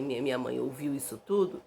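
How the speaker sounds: normal talking voice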